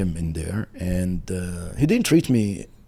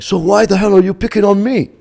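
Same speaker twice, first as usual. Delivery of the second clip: high, loud exasperated question; indignant outburst; 12 semitones higher, 9 dB louder than usual. Retold or imitated. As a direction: demand an explanation, voice jumping up. Outraged on learning they're cousins.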